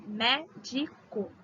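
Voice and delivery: "Médico" slow, "Mé" hit hard; stress on MÉ. Shown as a demonstration. Teacher showing students the stressed syllable. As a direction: lean on "Mé" in "Médico". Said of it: "a antepenúltima que é a forte".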